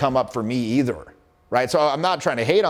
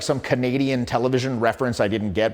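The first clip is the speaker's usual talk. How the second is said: usual speaking voice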